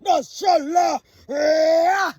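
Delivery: slow, called-out mock chant; imitating the terrorists. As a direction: drag the name out like a mock call. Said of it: "The terrorists go like".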